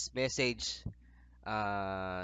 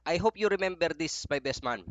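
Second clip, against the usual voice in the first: emphatic; 6 semitones higher, 6 dB louder than usual. Real, not acted. Emphatic wish to best man.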